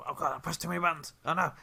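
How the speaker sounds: loud, high, rushed panic; flustered outburst. Real, not acted. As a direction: blurt it out fast, in real fluster. Game master flustered by controls.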